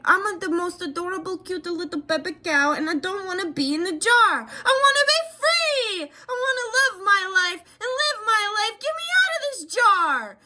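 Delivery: high, tearful cartoon baby cow. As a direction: squeal it like a sad little cow begging to be free. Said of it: "you were just sitting there like".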